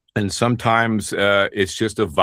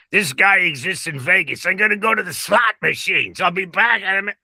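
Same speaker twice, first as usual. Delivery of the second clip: high, put-on Las Vegas gambler; Vegas guy voice; 10 semitones higher than usual. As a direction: play a chatty slot-machine regular. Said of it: "That's a real person".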